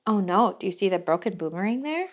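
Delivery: ordinary delivery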